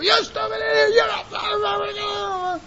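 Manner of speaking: high, shouted insult; mock angry outburst. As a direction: snap at someone in a rage. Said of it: "you get mad at somebody".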